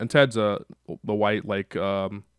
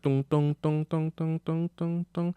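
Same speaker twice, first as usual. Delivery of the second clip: vocalised guitar plucks; 6 semitones higher, 3 dB quieter, slower than usual. Retold or imitated.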